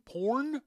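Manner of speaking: "porn" with a sounded R, high and loud; high, loud questioning "porn" with a clear R; rhotic "porn". Real, not acted. Asking what the audience heard, rhotic pronunciation. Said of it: "P O R N".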